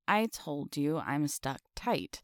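usual speaking voice

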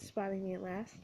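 ordinary delivery, nothing marked

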